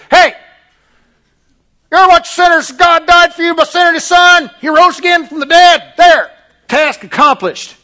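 put-on loud, high Southern accent shouting at sinners; southern street preacher. Pastor mocking a street preacher at a Pentecost crowd, Southern shout. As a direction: bellow it like a Southern revival preacher. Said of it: "Why do I speak in a southern accent".

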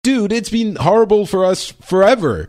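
high, incredulous imitation of the co-host; mock exasperated disbelief. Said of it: "and you're like".

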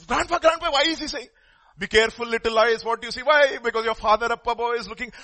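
put-on high-pitched, insistent child's questioning; pestering grandson. Grandson asks grandpa why he is watched, pestering child's voice. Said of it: "grandson goes to his grandfather".

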